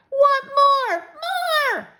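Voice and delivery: put-on high, loud wailing little-kid voice; crying toddler. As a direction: wail it through tears like a small child. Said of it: "Fudge started to cry".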